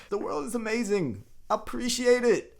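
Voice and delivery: high-pitched, joyful exclamation; acting out a hero thrilled to be home; elated hero. Heroes acted, back from a war.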